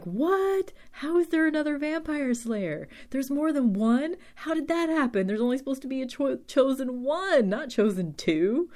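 high-pitched mock disbelief; incredulous shock. First-time viewer reacting to the cliffhanger, high incredulous shock.

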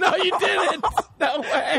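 high squeal of disbelief; shocked disbelief. Real, not acted. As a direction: gasp it out, astonished. Shocked reaction to story.

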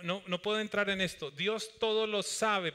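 usual speaking voice

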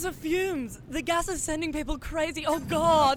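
loud, fast, frantic report; panicked reporter. Acted.

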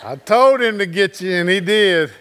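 gleeful, laughing triumph; laughing wife. Retold or imitated. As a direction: burst out with a happy laugh. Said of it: "she's like".

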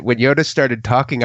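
ordinary delivery

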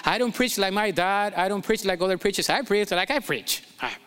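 ordinary talk, nothing marked

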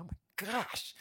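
usual speaking voice

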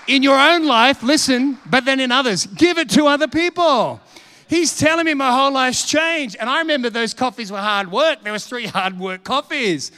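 forceful preaching. Real, not acted. Preacher urging church to give to others, forceful. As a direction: preach it out to the whole room.

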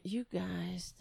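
breathy whisper to the chat; a hushed aside to the viewers. Real, not acted. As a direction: lean in and whisper it.

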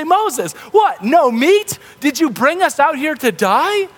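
high, whining mock complaint; whiny complaint. Israelites complain to Moses about food, high mock whine. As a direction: pitch it up like a grumbling crowd. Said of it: "they go to Moses and they complain".

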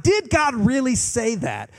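preacher mimicking society's doubt; incredulous challenge. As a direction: throw the doubt back at the crowd. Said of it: "society challenging God's word".

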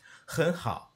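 很好 with a high, unfinished glottal dip; natural Mandarin. Shown as a demonstration. Teacher demos 很好 with glottal stop. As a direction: let 很好 stop short in the throat. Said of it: "this glottal stop not having to finish".

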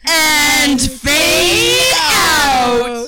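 slow loud closing announcement; announcer. Acted. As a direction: draw it out like a trailer. Narrator ending dramatically.

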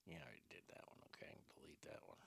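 quick whisper to himself; off-mic whisper to self. Real, not acted. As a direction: mutter it under your breath while checking notes. Muttering while checking notes.

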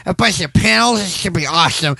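high, loud excited nerd. Retold or imitated. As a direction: pitch up like an eager nerd. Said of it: "some nerd going".

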